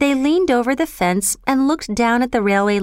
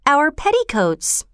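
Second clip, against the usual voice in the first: high, drawn-out exclamation; excited cry; 6 semitones higher than usual. A narrator's reading. Girl offering petticoats as red flags, excited cry. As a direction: announce the bright idea slowly, pitched up. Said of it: "Bobby cried".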